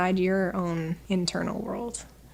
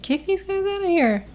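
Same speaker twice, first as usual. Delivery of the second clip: much higher mock command; high-pitched, light-hearted eviction; retold dismissal; 11 semitones higher than usual. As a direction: playfully order the hecklers out. Retells ordering out inner critics.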